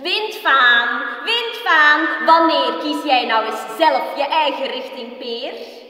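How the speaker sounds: loud, high, eerie taunting voice; mocking voices in Peer's head jeering that his mother is dead; spiteful voices. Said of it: "Valse, gemene stemmen".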